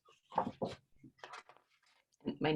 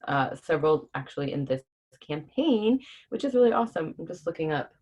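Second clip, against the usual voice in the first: ordinary delivery, nothing marked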